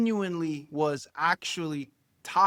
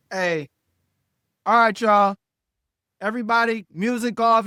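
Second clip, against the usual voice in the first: loud, raised party-ending announcement; acted commanding shout to the guests; calling out; 5 semitones higher, 8 dB louder than usual. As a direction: project over a noisy room. Acting out shutting the party down.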